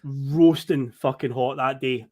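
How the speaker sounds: usual speaking voice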